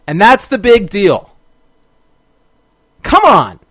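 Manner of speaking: mock the letdown; real indignation at the weak plot; exasperated. Real, not acted. Ranting about the episode.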